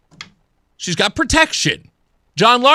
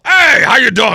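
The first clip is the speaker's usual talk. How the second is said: loud, hearty mock greeting; personable politician; 5 dB louder than usual. Host imitating a personable old politician, loud and hearty. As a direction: boom out a hello, tailing into blustery noise. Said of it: "because he's very personable".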